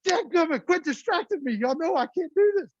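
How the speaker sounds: comic, exasperated retort; mock frustrated dad. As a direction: play the frazzled dad snapping at the kids. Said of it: "I'm like".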